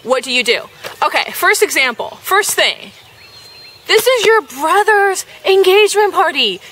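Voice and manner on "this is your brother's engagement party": raised, stern scolding; scolding the reader about the party. Real, not acted. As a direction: tell them off firmly. Scolding the quiz answer.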